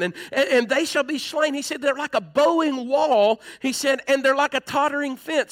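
impassioned preaching. Acted. Pastor voicing David's prayer to the church, fervent preaching. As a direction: climb with each line, preach it out.

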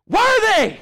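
sudden high, ringing shout; loud-voiced praise. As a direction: cry it out like an overwhelmed crowd of angels. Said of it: "they say with a loud voice".

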